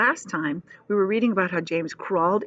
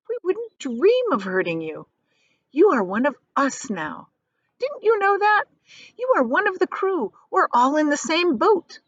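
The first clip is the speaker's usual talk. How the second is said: much higher, gentle reassurance; kindly ladybug; 9 semitones higher than usual. Acted. Ladybug reassuring James he is one of the crew, high and kind. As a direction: soothe the frightened boy. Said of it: "said kindly".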